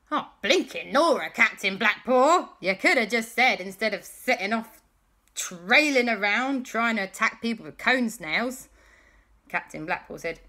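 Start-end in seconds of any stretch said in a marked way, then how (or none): 0.1-8.7 loud, higher-pitched telling-off; exasperated. Acted. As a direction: scold with weary disbelief. Exasperated reply to pirate.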